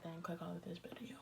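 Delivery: whispered aside. Real, not acted. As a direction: breathe it without voice.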